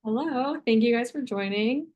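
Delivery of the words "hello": ordinary talk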